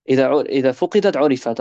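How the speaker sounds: fast, chant-like saying; chanted Arabic proverb; recited Arabic saying. Acted.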